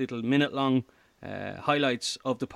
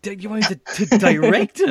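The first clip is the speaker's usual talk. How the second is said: higher, rising to a loud question; incredulous; 8 semitones higher, 10 dB louder than usual. Retold or imitated. Comedian retelling being asked to direct, incredulous. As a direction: blurt it out in mock disbelief. Retelling his disbelief at the offer.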